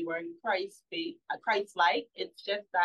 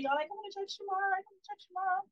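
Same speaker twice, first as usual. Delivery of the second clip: much higher, bubbling with excitement; a little girl telling the whole family her news; excited child voice; 12 semitones higher, 3 dB quieter, faster than usual. Retold or imitated. Mother imitating her daughter calling relatives, excited and high.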